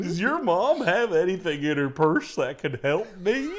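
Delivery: high, slow, pitiful mascot plea; hammed-up Goofy begging; pleading Goofy. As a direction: beg a kid like a sad cartoon dog. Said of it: "give me pills out of his mom's purse".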